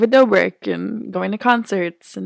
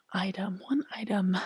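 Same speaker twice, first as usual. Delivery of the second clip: thinking aloud in a whisper; 10 dB quieter than usual. Real, not acted. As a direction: murmur it while thinking. Musing over the question.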